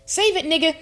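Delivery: high, punched kick-him-out outburst; angry dismissal. Retold or imitated. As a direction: cut him off indignantly. Acts out kicking boyfriend out.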